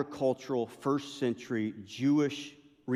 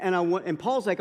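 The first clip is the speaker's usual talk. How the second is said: normal talking voice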